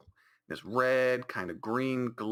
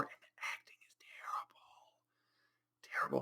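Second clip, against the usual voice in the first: hushed, unvoiced verdict; whispered aside; 3 semitones higher, 12 dB quieter, much faster than usual. Real, not acted. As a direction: whisper it like a secret jab. Whispered verdict on bad acting.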